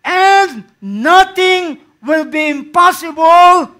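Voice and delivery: loud, high, slow, ending in a shout; preacher crying out the mustard seed promise; preaching at full force. Acted. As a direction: build to a shouted climax. Preaching climax.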